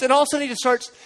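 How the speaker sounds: normal talking voice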